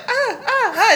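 high mock alarm; warning ah ah ah. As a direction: yelp in short bursts. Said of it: "Elise is just like".

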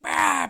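loud, high groaning cry; dragon death groan. Acted. Kid game master voicing the stabbed dragon, loud death cry. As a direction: blurt out a mock dying roar. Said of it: "a loud groan as the, the D M kid goes like".

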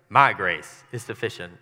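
heavy emphasis on "My"; stress on My. Shown as a demonstration. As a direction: hit the word "My" hard. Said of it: "Does that sound like".

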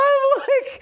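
high-pitched squeaky laugh; high giggle. Real, not acted. Laughing at his own story, high squeaky giggle. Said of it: "You're laughing right now".

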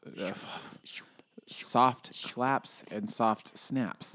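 slow, hushed whisper; soft ASMR. Pretend. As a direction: whisper it slowly, drawing out each word. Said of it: "and then soft cheers".

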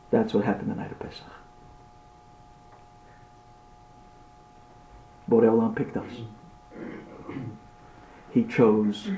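low, soft and full of long pauses; hushed, solemn storytelling; hushed suspense. Acted. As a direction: quietly draw out the moment of choice. Hush before the orphan is chosen.

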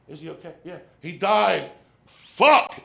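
from a hushed check to a loud high Fuck. Retold or imitated. Checking on the cat.

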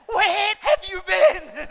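high, loud, slow exasperated yell with a laugh; frantic yell. Retold or imitated. Modern parent yelling at the lost boy, high and loud. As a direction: let it rip like a frantic mother. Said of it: "a Bible way of saying".

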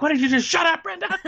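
high, loud mock outburst; Dylan, aggressive. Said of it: "Dylan is so aggressive".

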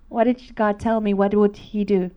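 usual speaking voice

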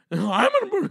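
very high, loud mock sputter; hammed-up rage breaking off; red-faced mad. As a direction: burst out like a furious airport complainer. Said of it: "they get all mad and red in the face".

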